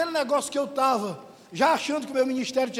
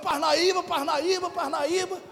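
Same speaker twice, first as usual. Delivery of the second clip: higher, eager repeated chant of the place name; getting excited; 4 semitones higher, slower than usual. Retold or imitated. Preacher recalling his joy at moving to Parnaíba, excited. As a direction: build excitement on each repeat, pitched up. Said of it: "comecei a me empolgar".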